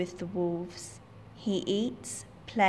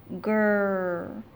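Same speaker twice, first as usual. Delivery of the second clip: panther growl. Acted. Terrible noise, panther arrives.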